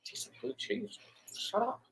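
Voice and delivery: high, breathy mock scoff, almost whispered. To the wife's friend about the olives, a hushed high scoff. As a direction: brush off her blue cheese gripe with a sneer. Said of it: "I'm like".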